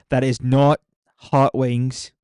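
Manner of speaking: each word of That is not hot wings spaced out, not loudest; emphatic, slow. Real, not acted. Streamer firmly ruling out hot wings for his subscribers, slow and emphatic. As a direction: stress on not, slow and drawn out. Insisting on a sub goal.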